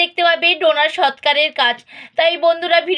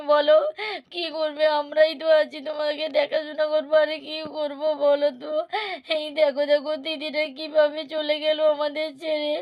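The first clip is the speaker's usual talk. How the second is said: put-on softer, gentle comforting of a crying child; soothing aunt; 7 dB quieter than usual. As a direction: hush the little one tenderly. (কাঁদতে থাকা মেয়েকে নরম গলায় সান্ত্বনা)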